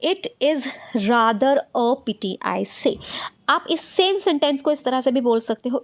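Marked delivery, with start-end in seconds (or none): 0.0-3.0 very slow, word by word; slow dictated sentence; slow dictation. Shown as a demonstration. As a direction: read the sentence out for copying. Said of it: "next sentence क्या है".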